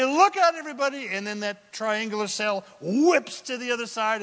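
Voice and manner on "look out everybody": high, loud call to the crew; mock warning shout. Preacher acting a sailor warning crew before tacking, mock shout. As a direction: call out a playful warning across the deck. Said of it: "and then you say".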